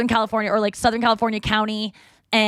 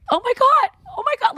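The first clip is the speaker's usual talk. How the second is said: freaking out. Retold or imitated. Neighbor freaking out on camera, high squealing panic. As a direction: shriek it like a neighbor losing it on camera. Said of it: "starts freaking out".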